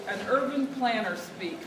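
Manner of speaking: normal talking voice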